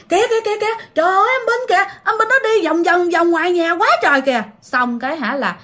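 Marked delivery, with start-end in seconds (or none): 0.1-4.4 high, urgent repeated cries; alarmed calling out. Retold or imitated. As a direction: cry out at spirits circling the house. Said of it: "Mà mẹ cứ kêu". (giọng kêu thất thanh, hoảng hốt)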